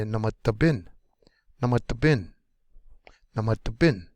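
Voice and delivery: "namata bin" with BIN accented most; stress on last syllable. Shown as a demonstration. Teacher demonstrating the accented ending, BIN stressed. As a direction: hit "bin" hardest, earlier syllables lighter. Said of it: "Last syllable is gonna be the most".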